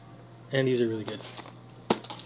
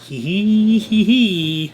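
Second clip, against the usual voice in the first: giggle; 5 semitones higher, 6 dB louder, much slower than usual. Real, not acted. Giggling after recording ends.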